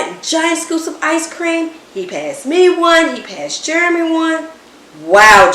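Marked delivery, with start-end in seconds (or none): 5.0-5.5 loud, amazed wow; impressed wow. A narrator's reading. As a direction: marvel at the pie. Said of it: "Jeremy said, looking at the pie".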